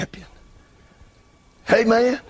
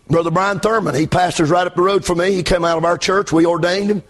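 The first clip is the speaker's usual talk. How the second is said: ordinary talk, nothing marked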